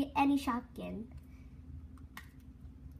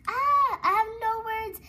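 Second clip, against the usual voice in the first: higher and louder, overwhelmed with joy; excited; 7 semitones higher, 7 dB louder than usual. Real, not acted. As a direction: burst out in delight. Delighted by the pretty toy.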